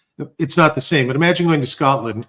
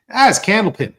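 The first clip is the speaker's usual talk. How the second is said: loud, high imitated retort; Lori Wickens; 9 semitones higher, 5 dB louder than usual. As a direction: blurt it out as the local witness. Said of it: "And he goes".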